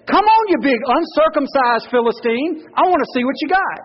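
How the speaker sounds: put-on cocky young David. Pastor acting David taunting the giant, high and cocky. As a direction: taunt like a fearless thirteen-year-old. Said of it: "he's saying".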